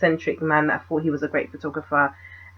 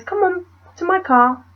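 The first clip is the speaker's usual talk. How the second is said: imitating the guy; 12 semitones higher than usual. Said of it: "The guy goes".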